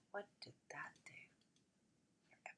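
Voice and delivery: quick whisper to herself; puzzled, hushed muttering under the breath; whispered aside. Real, not acted. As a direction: whisper it fast, barely audible. Talking to herself during a hitch.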